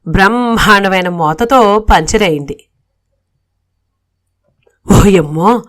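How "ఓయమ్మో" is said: a hint of a startled mother-in-law; mildly voiced old lady's fright; startled cry. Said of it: "హడిలిపోయి". (పేలుడు విని కాస్త భయపడిన అత్తగారి కేక)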